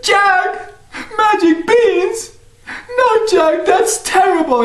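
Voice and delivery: very high, slow dismay; dismayed mother. Acted. Mother is dismayed at the beans, very high. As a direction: cry out in disbelief.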